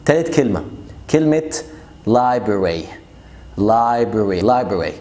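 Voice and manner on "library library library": library said as lye-berry, second R dropped; library without middle R. Shown as a demonstration. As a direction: say library with only the first R. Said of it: "ما تنطقش ال R تاني اللي في النص".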